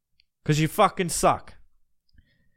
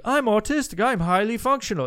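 mocking poser voice; 6 semitones higher than usual. Podcaster mocking a faker online, high mock voice. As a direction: parody a faker's proud claim. Mocking fake disorder posters.

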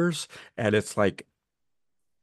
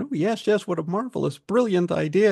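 much higher, gushing, overdone mock enthusiasm; mock fawning praise; 10 semitones higher than usual. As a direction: ham up the glad-handing yes-men. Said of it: "everybody's glad-handing and slapping each other and going".

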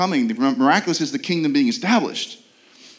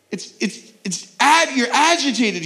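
ordinary talk

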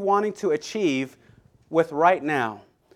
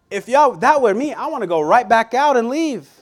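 exasperated; 6 semitones higher, 5 dB louder than usual. Real, not acted. Preacher's reaction to rude greeting.

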